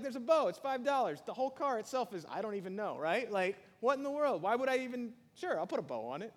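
high, loud exasperated disbelief; incredulous. Retold or imitated. Giver of a Mercedes asked for a bow, loud exasperated disbelief. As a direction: scoff at a bow on a free car. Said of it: "You'd be like".

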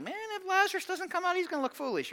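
squeaky-high doubting aside under the breath; skeptical onlooker. Retold or imitated.

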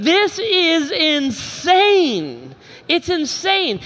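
high, very slow incredulous cry; exasperated disbelief. Retold or imitated. Dad watching miserable kids play soccer, slow high disbelief. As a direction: stretch out the disbelief. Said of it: "I'm like".

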